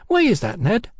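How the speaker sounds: high-voiced cheeky London girl. A narrator's reading. Hetty questions Mallory.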